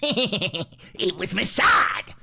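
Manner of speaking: slow, laughing shout; laughing punchline. Real, not acted. To the crowd, a slow laughing shout. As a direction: laugh it out big.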